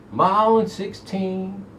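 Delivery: ordinary talk, nothing marked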